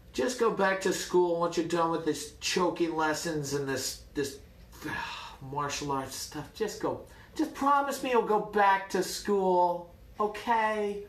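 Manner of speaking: mock-anguished fatherly begging, drawn out at the end; exasperated pleading father. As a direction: imitate a despairing dad begging his son. Father pleading, head in hands.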